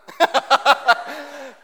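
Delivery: loud high burst of laughter; loud real laugh at a friend's discomfort; big laugh. Real, not acted. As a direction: really crack up at him. Laughing at squirming Lewis.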